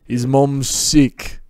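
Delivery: calm a worried friend slowly; reassuring. A narrator's reading. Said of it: "reassuring his best friend".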